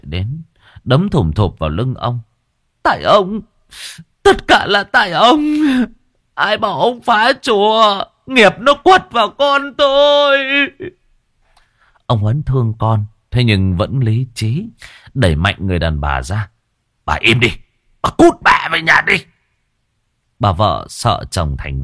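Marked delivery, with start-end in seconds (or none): 2.8-10.4 high, loud, wailing blame; grief-stricken accusation. A narrator's reading. Wife blaming husband for son's death, shrieking. 17.1-19.2 loud, harsh rebuke, rising higher; angry command. A narrator's reading. Husband shoving wife away, angry shout.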